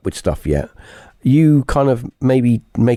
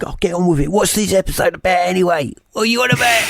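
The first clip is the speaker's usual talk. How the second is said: mocking impatient listener; 10 semitones higher, faster than usual. Mock listener complaint, high pitch.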